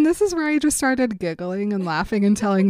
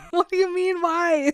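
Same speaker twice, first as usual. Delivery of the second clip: high-pitched mock disbelief; exasperated, incredulous comeback; incredulous retort; 9 semitones higher than usual. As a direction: throw the question back, voice shooting up. Acted quarrel, incredulous retort.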